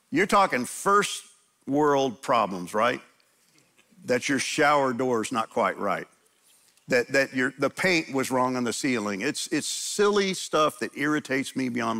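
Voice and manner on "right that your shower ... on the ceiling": usual speaking voice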